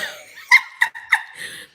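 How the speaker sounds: high airy burst of laughter; shrieking laugh. Real, not acted. Vlogger laughs at her own makeup attempt, shrieking laugh. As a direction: let a squealing laugh burst out.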